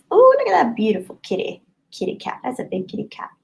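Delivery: high, delighted coo; high-pitched pet-admiring voice; cooing over a cat. Real, not acted.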